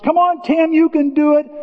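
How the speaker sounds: put-on high kid voice calling Tim; child cousins calling. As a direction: call up to him like a little kid in the pool. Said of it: "little tiny cousins are encouraging".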